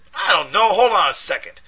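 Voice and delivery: high, loud street voice in a panic; panicked friend. A narrator's reading. Deshaun panicking down the phone at his friend, loud and high. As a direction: yell it like you're scared and angry.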